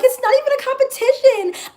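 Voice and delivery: high, snooty whine; mock whiny self. Mocking her old self dismissing rivals, high whiny voice. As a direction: toss it off in a high petty whine. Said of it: "And then I'd just be like".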